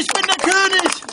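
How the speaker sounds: loud, high royal proclamation; roaring tyrant king. Acted. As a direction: bellow the claim to the throne. Artus proclaims himself king.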